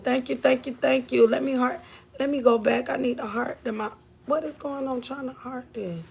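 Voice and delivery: ordinary talk, nothing marked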